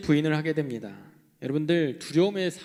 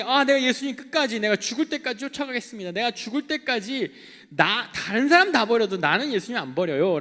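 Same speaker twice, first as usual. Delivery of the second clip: higher, louder boastful vow; proud boast; 7 semitones higher, 5 dB louder than usual. Retold or imitated. Preacher voicing proud Peter vowing to Jesus, boastful. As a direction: swagger through the promise. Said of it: "그렇게 한때는 교만하게".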